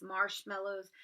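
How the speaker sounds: plain reading voice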